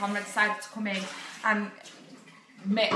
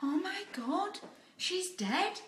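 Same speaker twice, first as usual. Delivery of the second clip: imitating son; 9 semitones higher, 6 dB quieter, slower than usual. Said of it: "he was like".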